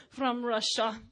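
slow put-on Russian accent; Natasha. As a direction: announce it as a playful Russian character. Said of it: "whatever character, Natasha".

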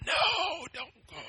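high, urgent hushed plea; a soft inner voice shouting without sound; hushed urgent warning; silent yelling. Retold or imitated. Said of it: "a real silent yelling".

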